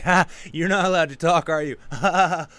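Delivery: loud laughing jeer; mocking taunt. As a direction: taunt them and laugh in their face. Taunting silent palace guards.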